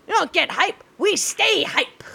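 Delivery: lightly voiced hype promo; Mojo impression. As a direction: hype it like a wrestler. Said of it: "buddy Mojo".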